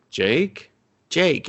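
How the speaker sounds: calling his name with mild worry. Retold or imitated. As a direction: call for him through an empty house. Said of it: "And she's just like".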